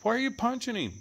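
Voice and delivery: high, exclaimed pet scolding; high-pitched scolding. Real, not acted. Owner asking a pet why he punches, high scolding.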